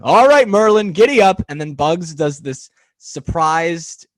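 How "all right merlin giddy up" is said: higher, louder offscreen call. Retold or imitated. Offscreen rider calling to the horse, loud and higher. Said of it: "a voice off screen going".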